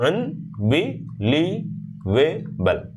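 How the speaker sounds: "अनबिलीवेबल" very slow, a pause after each syllable; broken into syllables. Shown as a demonstration. Teacher repeats the drill, "अनबिलीवेबल" syllable by syllable. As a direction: stretch "अनबिलीवेबल" into five separate beats. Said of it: "बीच-बीच में break करते हो".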